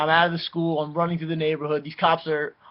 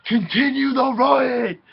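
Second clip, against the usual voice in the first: retold rally cry; 9 semitones higher, 5 dB louder, slower than usual. Friends restart the riot.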